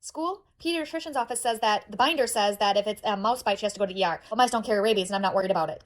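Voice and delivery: usual speaking voice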